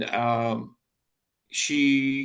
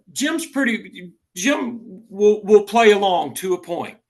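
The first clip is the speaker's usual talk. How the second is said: lightly mocking superintendent, pitched higher and louder; imitating a superintendent; 5 semitones higher, 6 dB louder, slower than usual. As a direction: play a boss half-teasingly sizing Jim up. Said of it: "superintendents that would say".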